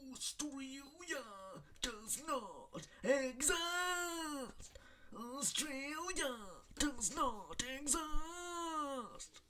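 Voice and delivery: very high, slow drawn-out wail; high slow wailing imitation of Ryan Reynolds; Ryan Reynolds impression. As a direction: stretch every word in a high whine. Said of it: "as Ryan Reynolds would say".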